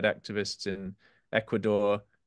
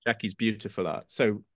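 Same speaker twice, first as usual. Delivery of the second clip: ordinary talk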